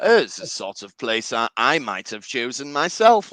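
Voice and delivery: lightly put-on captain's musing; Picard voice. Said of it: "Picard says something weird".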